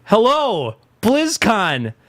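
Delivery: high, very slow cheesy greeting; mock Diablo announcer. Mocking the Diablo mobile announcement host, high slow voice. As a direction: drag it out like a stiff stage host. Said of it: "he's like".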